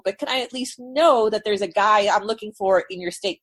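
usual speaking voice

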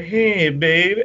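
a hint of a wrestler chatting someone up; Slick. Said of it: "and he's like".

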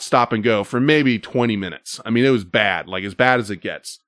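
usual speaking voice

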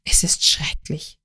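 hushed, tearful whisper. A narrator's reading. A woman confessing to her lover, whispering through tears. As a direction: she breathes the words out, close to tears. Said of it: "ihre Augen füllten sich mit Tränen".

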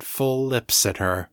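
usual speaking voice

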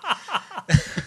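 high burst of laughter; laughing. Real, not acted. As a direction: crack up at your own botched attempt. Cracks up at slaughtered accent remark.

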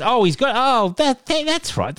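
oh that's right, rising high and excited; sudden realisation. Real, not acted. Host realising why he reads email aloud, surprised.

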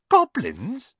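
high, loud startled question; shocked, drops the food. Acted. Harry startled, drops sausage.

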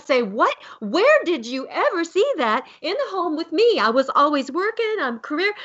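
high-pitched, indignant Southern mom. Retold or imitated. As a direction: go Southern on your son in disbelief. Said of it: "I'm gonna go Southern on you here".

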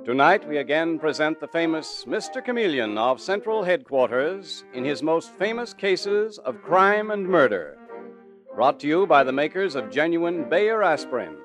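put-on booming old-time radio intro; old radio announcer. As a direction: announce it like a 1940s broadcast. Announcer opens the radio show.

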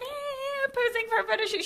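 high, soft airy mimicry; lightly mock a star busy posing; oblivious posing star. Imitating Hailee who didn't notice them, high mock voice.

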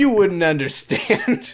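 smug and slow, laughing. Pretend. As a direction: talk down smugly. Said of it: "I say smugly".

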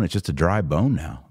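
usual speaking voice

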